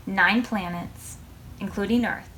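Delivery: plain reading voice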